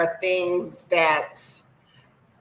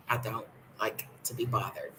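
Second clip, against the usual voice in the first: muttered repeat; 8 semitones lower, 10 dB quieter than usual. Shown as a demonstration. Vlogger grumbles to viewers she wants to be left alone, quietly. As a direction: lower and much softer, grumbled.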